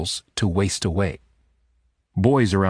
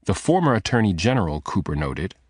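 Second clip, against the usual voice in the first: ordinary delivery, nothing marked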